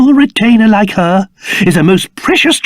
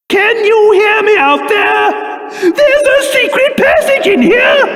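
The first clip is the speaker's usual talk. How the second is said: calling out; 12 semitones higher than usual. Acted. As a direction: shout through the wall. Calling out from inside.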